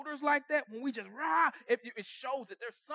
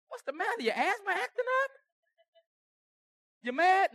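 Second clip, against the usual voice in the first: higher, teasing questions; mocking taunt; 11 semitones higher than usual. Needling someone breathing hard at home, mocking. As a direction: poke fun at them.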